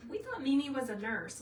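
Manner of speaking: lightly voiced surprised children; children's voices. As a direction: pitch it up like the children talking. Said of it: "My children say".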